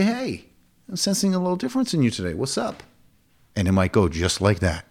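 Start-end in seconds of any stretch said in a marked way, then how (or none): 0.0-2.8 higher-pitched, casual check-in; client check-in. Acted. Coach sensing a change in a client, higher-pitched concern. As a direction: lift the voice to ask what's wrong.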